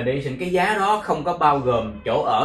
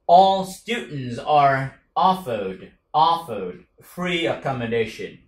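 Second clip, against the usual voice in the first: very slow, every word spelled out; slow, careful demo sentence; slow model reading; slower than usual. Shown as a demonstration. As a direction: read it slowly for learners to copy. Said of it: "slow model sentence".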